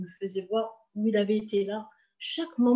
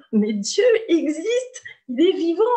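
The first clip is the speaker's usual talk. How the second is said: crying out; 7 semitones higher, 7 dB louder than usual. Real, not acted. Alone in her bedroom, crying out that God lives. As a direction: let the tears break through the words.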